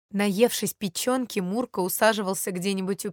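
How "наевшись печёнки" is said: ordinary delivery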